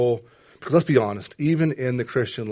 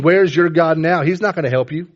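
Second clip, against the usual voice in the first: loud, higher preacher's jeer; mocking taunt; 5 semitones higher, 7 dB louder than usual. Preacher voicing enemies over the wounded psalmist, loud mocking taunt. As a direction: jeer at a beaten man. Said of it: "laughing and mocking me".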